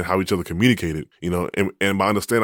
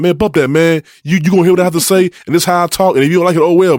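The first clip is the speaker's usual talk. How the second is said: high, loud, dismissive comeback; acted-out defiance; 10 semitones higher, 7 dB louder, faster than usual. Husband imagines refusing to be humble with wife, loud defiance. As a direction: imitate someone stubbornly refusing to back down. Husband voices his unhumble reaction.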